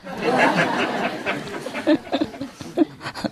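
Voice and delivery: soft airy laugh at the punchline; breathy laugh. Real, not acted. Teller laughing at own punchline, soft airy laugh.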